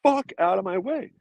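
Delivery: high, pushy mock yell; retold yell at beginner surfers in the water. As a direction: shove them aside with your voice. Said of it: "I'm just like".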